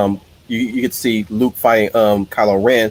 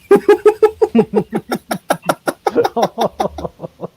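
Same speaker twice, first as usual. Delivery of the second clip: laughing; 13 semitones higher than usual. Real, not acted.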